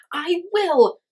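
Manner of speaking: high, loud defiant snap; stung boy insisting; rattled. A narrator's reading. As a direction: fire it back, shaking with anger. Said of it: "rattled Marsh Wren".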